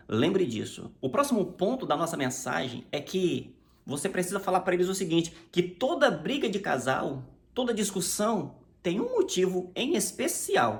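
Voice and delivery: ordinary talk, nothing marked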